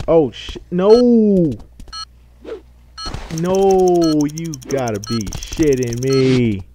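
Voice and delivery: loud furious yell at a game; screaming in gamer rage; raging gamer. Real, not acted. Gamer fails jump, screams.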